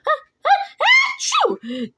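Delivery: very high build-up into achoo; loud, high-pitched kitten sneeze; pretend kitten sneeze. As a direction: fake a big squeaky sneeze. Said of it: "he sneezed".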